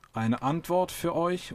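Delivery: slow, high, measured pronouncement; dignified interruption. A narrator's reading. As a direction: cut in with understated dignity. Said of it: "unterbrach ihn Deep Thought würdevoll".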